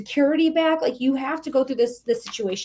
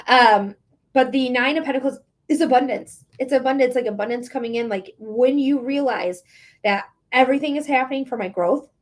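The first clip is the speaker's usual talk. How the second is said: normal talking voice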